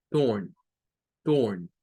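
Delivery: torn said as tun, low; torn, vowel like u with r; low teacher model of torn. Shown as a demonstration. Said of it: "suena como que fuera una U R".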